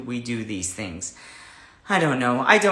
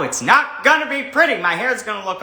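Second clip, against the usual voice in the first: mock dramatic warning; 6 semitones higher, 4 dB louder than usual. Real, not acted. Warning viewers about her hair, high and mock dramatic. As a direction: ham up the warning.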